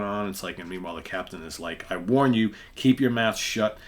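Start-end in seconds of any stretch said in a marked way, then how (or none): 1.9-3.8 stern warning. Acted. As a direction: lean in and warn him off. Captain warns the frightened sailor.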